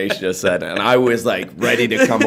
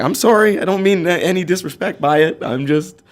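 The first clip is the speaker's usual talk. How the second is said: high-pitched retold apology; imitated flustered apology, pitched up high; apologetic protest; 9 semitones higher than usual. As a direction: plead innocence in a raised, high voice. Acted apology to offended older women.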